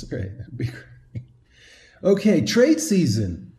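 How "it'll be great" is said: whispered. Acted. Echoes the joke under breath.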